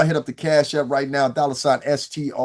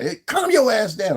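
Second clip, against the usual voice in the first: mock order; 9 semitones higher, 4 dB louder than usual. Acted man telling woman off.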